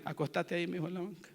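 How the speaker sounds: mock the disciples as too calm; calm and soft. Pastor mocking a calm, gentle wake-up, soft easy voice. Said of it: "¿Será que lo hicieron así?".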